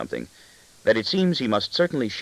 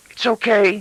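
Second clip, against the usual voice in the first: put-on much higher, louder small boy's voice; breathless reassurance; 13 semitones higher, 6 dB louder than usual. A narrator's reading. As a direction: hurry to reassure like a frightened child. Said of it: "he assures me breathlessly".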